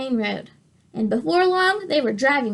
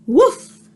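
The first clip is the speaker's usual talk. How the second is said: loud, high mock woof; dog bark; 6 semitones higher, 7 dB louder than usual. Dog answers Duck with a playful loud bark. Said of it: "said Dog".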